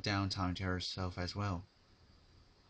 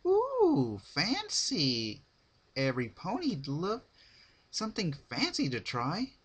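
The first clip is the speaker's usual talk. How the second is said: high, sing-song excited chatter; Pinkie Pie voice; 9 semitones higher, 5 dB louder than usual. Acted. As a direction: bounce up and down with delight. Pinkie excited at new pony.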